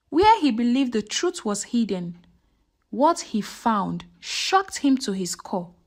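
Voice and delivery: usual speaking voice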